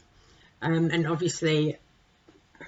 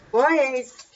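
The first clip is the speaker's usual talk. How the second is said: calling out; 8 semitones higher, 6 dB louder than usual. Real, not acted. Calling toddlers to order.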